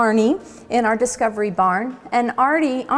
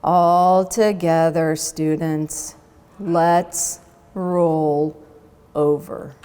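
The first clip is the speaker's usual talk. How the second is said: sleepy teacher; 6 semitones lower, slower than usual. Acted. Sleepy teacher voiced in story.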